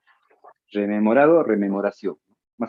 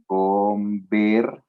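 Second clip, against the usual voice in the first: slow drawn-out word; much slower than usual. Shown as a demonstration. Teacher spelling out a word for students, slowly. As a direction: stretch each syllable of Conversa slowly. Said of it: "Y ya tú imaginas cómo va a ser".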